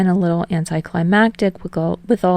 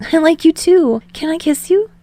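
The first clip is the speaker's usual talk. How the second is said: high, bright, giddy reply; Hinata voiced high; 10 semitones higher, 4 dB louder than usual. Hinata answering Tsukishima's confession, high giddy voice.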